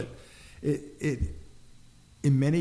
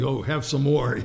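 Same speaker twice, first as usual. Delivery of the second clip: imitated urging mother; 4 semitones higher, 7 dB louder than usual. Mother urging food, acted.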